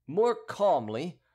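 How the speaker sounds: slow, high-pitched gentle command; soft, imperative. A narrator's reading. Hidden voice restraining the Gascon, soft but imperative. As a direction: firmly urge restraint, drawn out. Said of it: "at once soft and imperative".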